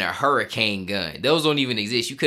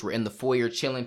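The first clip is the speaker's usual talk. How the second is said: normal talking voice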